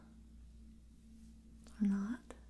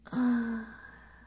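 short breathy chuckle; 6 dB louder than usual. Acted. Vampire amused while explaining how it feeds, breathy chuckle.

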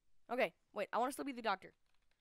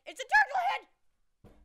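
shouting; 13 semitones higher, 15 dB louder than usual. Pretend. Joking, shouted.

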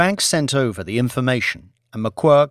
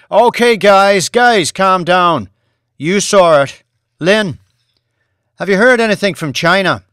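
calm them like a conductor with his palms; McQuirk voice; 7 semitones higher, 9 dB louder than usual. A narrator's reading. Boss calming his staff in a raised voice.

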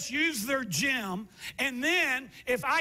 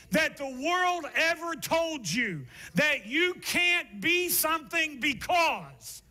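slow, rising, higher preaching build; building sermon climax; 3 semitones higher, slower than usual. Acted. Pastor building to the point before the congregation. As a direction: stretch every word as the sermon swells.

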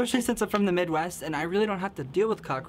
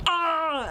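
startled scream; 10 semitones higher than usual. Retold or imitated. Freaking out at cockroach.

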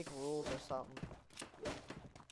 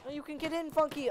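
high loud call; calling from far away; 10 semitones higher, 4 dB louder than usual. Real, not acted. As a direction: shout it out to a teammate. Calling to teammate Funky.

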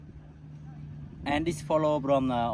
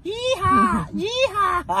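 high, loud cowboy whoop; yeehaw; 8 dB louder, much slower than usual. Retold or imitated. Laughing as he imitates a cowboy, loud yeehaw. Cowboy cry after explanation.

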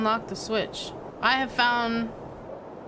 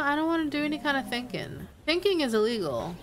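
ordinary delivery

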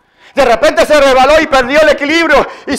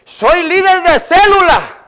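high, drawn-out proud claim; boastful climber; 7 semitones higher than usual. Retold or imitated. Climber telling God he leads a cell, boastful claim. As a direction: announce the title as an acted boast. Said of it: "Así le dice".

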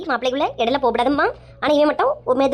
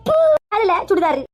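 higher, whimpering mock sob; mock crying; 6 semitones higher than usual. Slapped wife whimpering at her husband, mock crying. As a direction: whine it through fake tears. After being hit.

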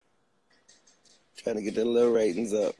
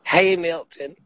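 loving greeting; 7 semitones higher, 9 dB louder, slower than usual. Acted. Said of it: "That's what they'll say if they love him".

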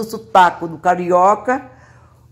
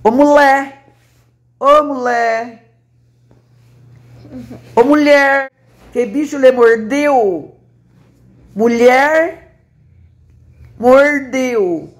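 teasing call; 5 semitones higher, slower than usual. Acted.

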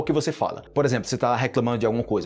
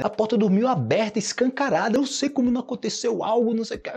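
much higher amazed exclamation; mock alarm; 9 semitones higher than usual. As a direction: act shocked at the open door. Exaggerating the wide open door. (espanto exagerado e agudo)

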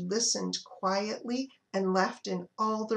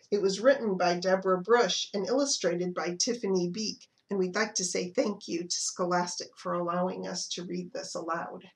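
usual speaking voice

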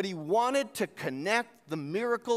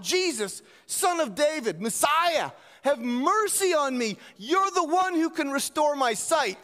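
pleading call to a passing crowd, raised but not a full shout; mock beggar's cry for help, mildly voiced; 6 semitones higher than usual. Blind man calls out to Jesus, a mild acted plea. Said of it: "he's calling out".

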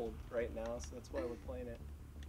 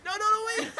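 high, loud, laughing panic; panicked laughing shout; 12 dB louder than usual. Real, not acted. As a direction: shout it laughing as you're left behind. Car drives off without him.

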